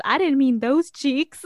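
high, slow, cheeky character voice; Sad Eye Joe. Retold or imitated. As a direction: imitate a sly tease, drawing it out. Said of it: "He goes".

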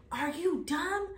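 high incredulous scold; scolding friend. Retold or imitated. Best friend scolding her for getting in, high disbelieving. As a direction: snap at her naive friend.

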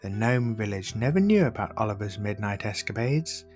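ordinary delivery, nothing marked

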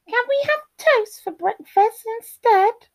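tiny, high squeaky cub voice; Baby Bear. A narrator's reading. Baby Bear asks for another breakfast, high squeaky voice. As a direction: ask it high like a little bear. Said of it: "said Baby Bear".

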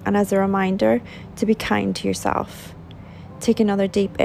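calm, measured meditation guide with long pauses; soothing relaxation-exercise voice, phrase by phrase; guided meditation. Acted. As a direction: unhurriedly guide them through a body scan, leaving space to breathe. Leads a guided body scan meditation.

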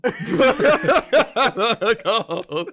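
comedian to friends about his aged look, cracking up laughing. Real, not acted. Say it through a big laugh. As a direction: burst into loud, high laughter on the words. Laughing over retold haircut result.

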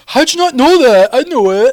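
high, loud fan uproar; outraged fans. Acted. Fans hounding a host who forgot a name, mock outrage. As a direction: yell it like indignant fans. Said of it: "All the fans are going".

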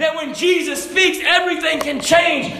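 high, loud pulpit proclamation, stretching key words; preaching at full force. Acted.

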